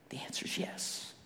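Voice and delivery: breathy whispered answer. Real, not acted. Preacher whispering the answer to the congregation. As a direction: hush it, let it land. Hushed answer after build.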